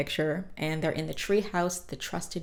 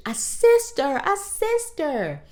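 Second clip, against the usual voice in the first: much higher, louder, dragging out the second one; overjoyed squeal; 11 semitones higher, 5 dB louder, slower than usual. Retold or imitated. DW thrilled about her new sister, squealing high. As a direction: squeal it in delight.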